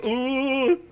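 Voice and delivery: mock ooh. Mocking the stab threat.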